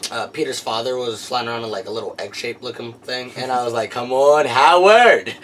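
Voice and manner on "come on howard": mildly hammed-up loud cheer; eager calling out. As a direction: call out as if rooting for someone. Re-enacts rooting for Howard to appear.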